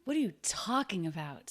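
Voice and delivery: TALKING stressed, the words round it lighter. Shown as a demonstration. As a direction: punch the first syllable of talking. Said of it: "he's really stressing the word talking here".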